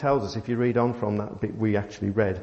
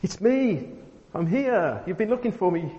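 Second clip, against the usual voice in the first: much higher, bright hammed-up "it's me"; excited mock reveal; 9 semitones higher than usual. What Jesus did not say to Mary, as a hammed-up excited reveal. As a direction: play an over-the-top joyful reveal.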